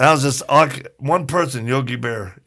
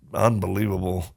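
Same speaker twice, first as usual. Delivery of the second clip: ordinary talk, nothing marked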